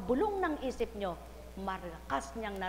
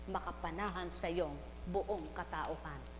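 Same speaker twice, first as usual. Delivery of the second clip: usual speaking voice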